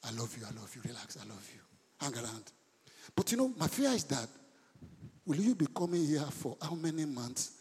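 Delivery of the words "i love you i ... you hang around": much softer, lower tender reassurance; calm them down gently, almost under your breath; reassuring. Real, not acted. Reassuring congregation after joke.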